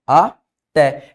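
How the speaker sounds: "até" slowly split, a, pause, té; syllable by syllable. Shown as a demonstration. Teacher showing how to separate "até", syllable by syllable. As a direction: say "até" in two separate beats. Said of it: "eu separo assim".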